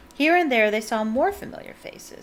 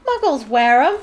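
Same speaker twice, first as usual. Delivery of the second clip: loud, higher stubborn insistence; 5 semitones higher, 10 dB louder than usual. A narrator's reading. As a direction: insist loudly, refusing to budge. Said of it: "said the old wizard stubbornly".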